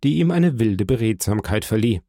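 plain reading voice

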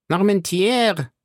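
high, slow, drawn-out put-on voice; Porthos reading aloud. A narrator's reading. The big musketeer reads the town's name, slowly and high. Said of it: "las Porthos".

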